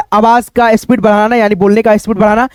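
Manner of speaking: ordinary delivery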